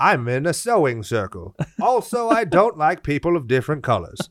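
mock a prim Southern church lady; Southern accent. Mocking an old Southern lady, Southern accent. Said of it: "I go to the South".

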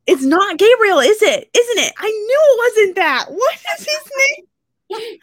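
loud, high burst of sudden realization; excited outburst. Real, not acted. Host suddenly realizing the character's name is wrong, loud and excited. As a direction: it hits her mid-sentence that the name is wrong, so she blurts it out.

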